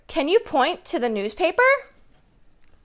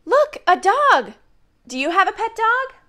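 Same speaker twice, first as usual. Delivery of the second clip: excited, high; 7 semitones higher than usual. Real, not acted. Excited to kids.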